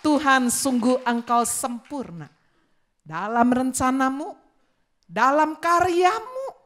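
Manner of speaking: loud preacher prayer building up; fervent praise prayer. Acted. Preacher leading praise to God, loud and fervent. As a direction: lift the prayer to God with fervour.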